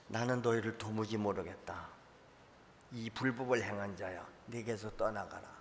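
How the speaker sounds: 1 soft, cold dismissal; 1 send him away quietly but firmly; 1 stern judgment. Acted. 1 the Lord rejects the false prophet, quiet and stern.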